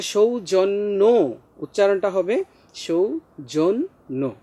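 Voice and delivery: সৌজন্য slowly as shou, jon, no; syllable-by-syllable demo. Shown as a demonstration. Teacher repeats the correct form, slow careful articulation. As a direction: separate each syllable of সৌজন্য, round o last. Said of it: "নয়ের ওকার উচ্চারণ হবে".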